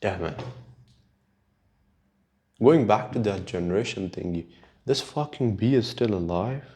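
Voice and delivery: ordinary talk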